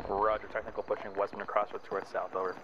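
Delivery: crackly military radio. Real, not acted. AI radio callout in game.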